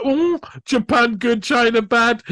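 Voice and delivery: high mocking sing-song; teasing sing-song. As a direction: tease it like a playground jeer. Repeat, higher teasing.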